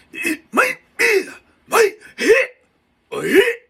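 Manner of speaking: loud, very high mock samurai cries; explosive pretend samurai yelps. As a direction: bark it out like a hammed-up samurai. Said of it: "that's my samurai right there".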